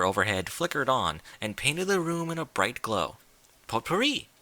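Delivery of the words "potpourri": sing-song. A narrator's reading. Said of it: "Rarity answered in a sing-song voice".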